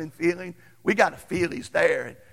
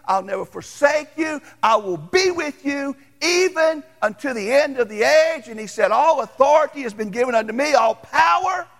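preaching at full force; 9 semitones higher, 4 dB louder than usual. Acted. Pastor preaching Jesus' promise to the congregation, full-force preaching. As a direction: build it up like a revival preacher. Preaching climax over music.

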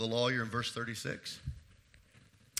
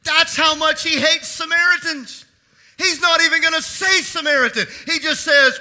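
full-force preaching; 19 dB louder than usual. Acted. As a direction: proclaim it to the whole church.

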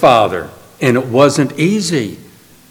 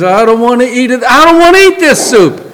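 put-on whiny boy; 7 semitones higher than usual. Boy refusing his soup.

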